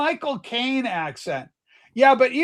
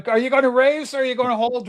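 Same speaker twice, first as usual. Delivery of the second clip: ordinary talk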